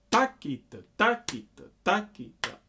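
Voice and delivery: "Takita" chanted with TA punched high and loud; accented first syllable. Shown as a demonstration. Teacher showing the accent in every "Takita", first syllable stressed. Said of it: "accent the first syllable in every takita".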